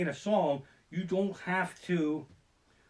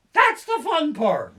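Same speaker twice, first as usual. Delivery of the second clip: slow excited exclaim; 10 semitones higher, 9 dB louder, slower than usual. Real, not acted. Coaster fan exclaims.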